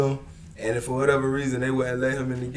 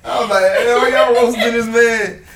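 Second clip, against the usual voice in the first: loud, higher, laughing mock protest; 9 semitones higher, 10 dB louder than usual. Retelling defending the Uber driver, laughing loudly. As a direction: defend him through laughter.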